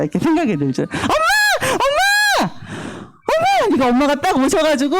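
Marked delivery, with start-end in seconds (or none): 0.8-3.7 high-pitched repeated cries for mom; child calling mom. Acted. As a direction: play a kid urgently calling out.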